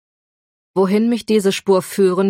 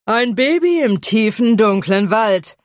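teasing dragon; 4 semitones higher, 4 dB louder than usual. Acted.

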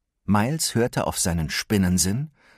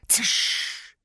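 unvoiced hiss; sharp whispered hiss; hissing web sound; 3 dB quieter than usual.

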